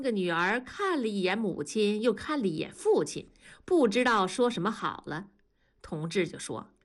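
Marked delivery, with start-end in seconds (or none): none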